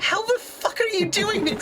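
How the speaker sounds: high, disbelieving outburst; exasperated at the impossible luck. Real, not acted. Incredulous at player.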